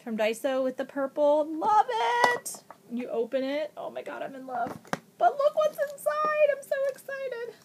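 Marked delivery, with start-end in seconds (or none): 1.5-2.6 high, louder delighted cry; excited squeal. Real, not acted. Opening a friend's gift, high loud squeal. Unboxing a cute file case. 5.2-7.5 very high squeaky voice; excited squeal. Real, not acted. Showing viewers a friend's gift, very high excited squeal. Opening the file case.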